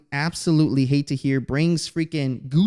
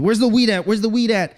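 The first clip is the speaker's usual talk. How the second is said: panicked; 6 semitones higher, 3 dB louder than usual. Retold or imitated. Panicking teen searching for weed.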